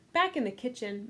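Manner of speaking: ordinary talk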